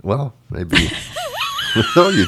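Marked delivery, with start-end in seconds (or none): 1.0-1.9 raspy smoker's cackle; hoarse raspy laugh. Real, not acted. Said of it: "I have such a smoker's laugh".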